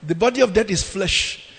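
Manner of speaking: ordinary talk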